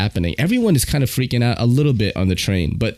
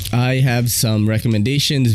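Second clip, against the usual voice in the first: usual speaking voice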